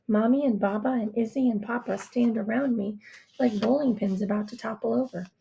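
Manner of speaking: ordinary delivery, nothing marked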